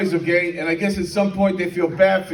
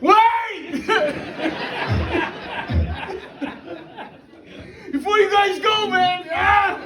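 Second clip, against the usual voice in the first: put-on high drunken yell through laughter; drunk shout; 10 semitones higher, slower than usual. Drunk groom stopping his friends at the door, high yelling. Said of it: "you're like".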